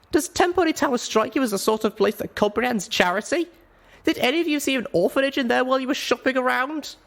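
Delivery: put-on high, impassioned young pony voice; a small mare arguing with all her heart; young Littlepip pleading. Narrator voices Littlepip's plea.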